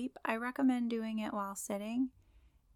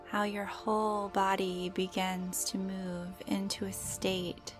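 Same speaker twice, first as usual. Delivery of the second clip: guided meditation; slower than usual. Acted. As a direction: gently guide the listener into calm.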